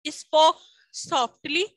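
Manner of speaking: stretched out slowly, word by word; slow dictation pace. Shown as a demonstration. Teacher to class, dictating slowly. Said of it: "speak એટલે બોલવું".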